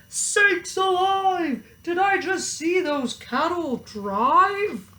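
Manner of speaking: high, slow astonished shout; sheriff shouting. A narrator's reading. Sheriff shouting at driving cows, high and amazed. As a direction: sheriff can't believe his eyes. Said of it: "The sheriff shouted".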